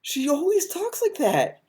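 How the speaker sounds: high, drawn-out disbelief; exasperated protest. Real, not acted. Reacting to a video's claim about Harley's voice, exasperated.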